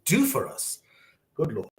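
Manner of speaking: halting, pausing to collect yourself; moved by the memory, with long breaks; getting emotional. Real, not acted. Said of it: "this is so emotional when I remember".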